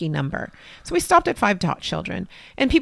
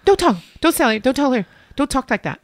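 higher, louder scolding mom voice; a mother snapping at her daughter; 6 semitones higher, 6 dB louder than usual. Retold or imitated.

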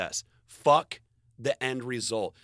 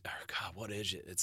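whisper; 4 semitones lower, 10 dB quieter than usual. Real, not acted. Losing her words mid-sentence.

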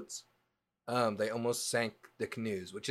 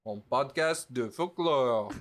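French accent; 3 semitones higher, 5 dB louder, slower than usual. Shown as a demonstration. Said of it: "how to say a folklore podcast in French".

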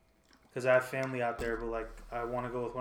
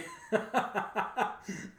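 breathy laugh; 8 semitones higher than usual. Real, not acted.

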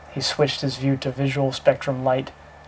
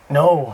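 groaned; 7 dB louder than usual. A narrator's reading. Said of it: "Howard groaned".

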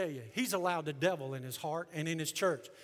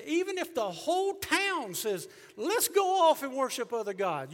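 preaching shout; 6 semitones higher, 8 dB louder than usual. Real, not acted. Preaching climax about the whole town.

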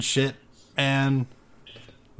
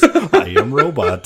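slow, loud robot voice, laughing; toy robot voice; 4 semitones higher, 8 dB louder, slower than usual. Retold or imitated. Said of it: "it was the voice".

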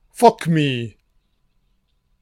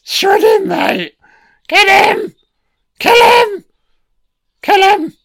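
cheering him on; 3 dB louder than usual. Real, not acted. Cheering on the film's fight.

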